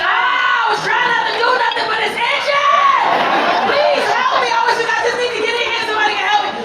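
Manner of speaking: high, loud desperate pleading shout; selling it loud. Retold or imitated. To the Kaiser desk lady, a loud desperate plea. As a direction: yell it like the itch is unbearable. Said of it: "And I sold it".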